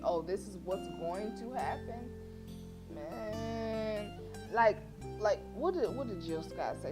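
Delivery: usual speaking voice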